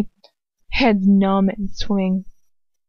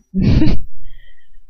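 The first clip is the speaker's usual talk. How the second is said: breathy burst of real laughter; 6 dB louder than usual. Real, not acted. Reader laughs mid-story.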